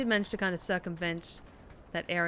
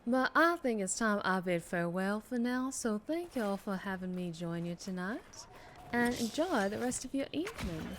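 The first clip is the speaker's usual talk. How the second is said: breathy southern voice. Acted. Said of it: "kind of breathy southern voice".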